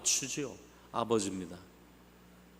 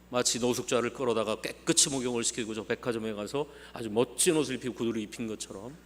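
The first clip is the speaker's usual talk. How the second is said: ordinary talk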